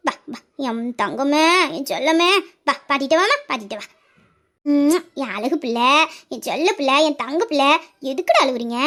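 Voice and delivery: doting grandmother's lull; tender cooing. Acted. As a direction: soothe a sobbing grandchild. Grandmother cuddles the crying child.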